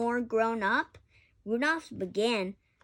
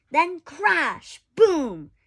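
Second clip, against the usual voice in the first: loud, punchy sound words; dramatic crash; 5 semitones higher, 9 dB louder, much slower than usual. A narrator's reading. Child reading the fight, loud crash and boom.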